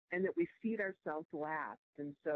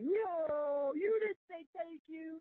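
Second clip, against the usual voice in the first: high, incredulous protest; mock the outraged youngsters pitched way up; indignant young people; 10 semitones higher, slower than usual. Young listeners protest her thank you, pitched high and incredulous. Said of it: "they're like".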